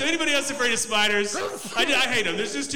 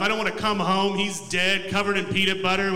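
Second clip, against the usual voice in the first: ordinary talk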